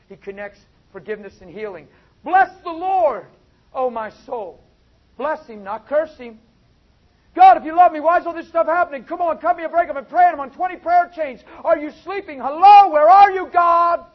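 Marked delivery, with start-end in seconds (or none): 2.2-6.4 high, loud, slow cry of praise; preacher roaring a blessing over the church; shouted praise. Real, not acted. Preacher exhorting at full force. 7.3-14.0 much higher, much louder complaining cry; preacher mocking a believer who nags God in prayer; mock whining prayer.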